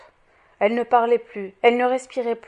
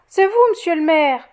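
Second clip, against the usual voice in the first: higher, louder exclamation of surprise; a sudden raised-voice greeting; startled cry; 6 semitones higher, 8 dB louder than usual. A narrator's reading. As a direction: cry out, caught off guard. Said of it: "s'écria-t-elle".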